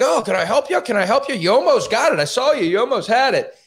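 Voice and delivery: high, excited imitation of a fussing helper; comic over-keen priest, high-pitched; eager helpful priest. Acting out eager priest offering help.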